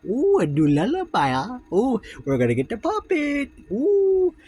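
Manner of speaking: high, loud delighted squeal; excited squeal. Real, not acted. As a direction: squeal with glee at the new chapter. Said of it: "squeals about the new lullaby".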